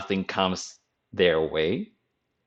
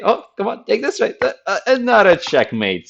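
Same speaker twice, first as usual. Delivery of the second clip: much higher, exasperated cry; mock frustrated whine; 11 semitones higher, 6 dB louder than usual. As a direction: whine as the losing player. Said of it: "you can say".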